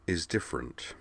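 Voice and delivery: soft, cool finish; cooler tone. A narrator's reading. Amanda hardening on hearing of the scan, cool tone. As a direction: say it quietly and coolly. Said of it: "in a cooler tone of voice".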